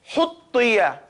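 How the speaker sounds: "Huttiya" with a forceful h, corrected model take; hard ha. Shown as a demonstration. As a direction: push out the h of "Huttiya" firmly. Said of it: "ha has to come hard".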